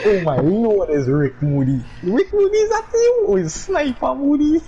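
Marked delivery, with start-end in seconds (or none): 2.0-4.6 high excited announcer tease; mock hype. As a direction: shout the name like a hype man. Mock hype at opponent's name.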